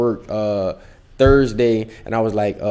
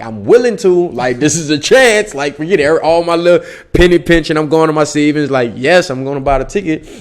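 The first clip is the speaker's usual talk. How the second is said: louder, higher resolve; determined; 6 semitones higher, 7 dB louder than usual. Retold or imitated. Telling mom he'll spend his savings, fired up. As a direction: throw caution aside.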